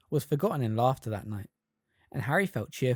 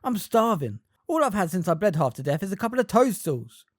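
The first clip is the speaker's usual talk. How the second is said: grumbling; 6 semitones higher, 5 dB louder than usual. A narrator's reading. Ron grumbling hungry.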